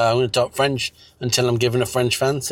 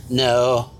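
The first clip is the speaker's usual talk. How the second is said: ordinary delivery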